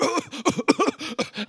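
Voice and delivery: gasping, spluttering like someone drowning; imitated choking. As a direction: mimic someone nearly drowning, choking for air. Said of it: "and you're like".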